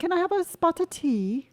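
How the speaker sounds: British accent, playful demo. Shown as a demonstration. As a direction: do your best British accent. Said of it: "Let's hear yours".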